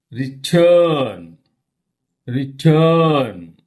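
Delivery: "Return" as re-TURN, the second syllable long and falling; stress on second syllable. Shown as a demonstration. Teacher demonstrates words with weak prefixes, second syllable stretched. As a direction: draw out and punch TURN in "return". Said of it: "stress fell on second syllable".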